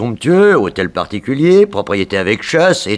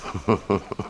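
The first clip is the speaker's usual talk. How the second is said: short laugh; 8 dB quieter than usual. Acted. Villain scheming with detective.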